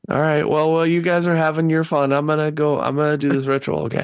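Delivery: high, put-on character voice; high-pitched figure announcing his errand; put-upon character. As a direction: excuse yourself in a high, even voice. Game master voices character leaving group.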